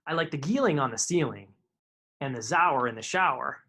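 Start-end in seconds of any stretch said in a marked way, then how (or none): none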